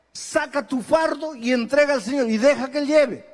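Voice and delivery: ordinary delivery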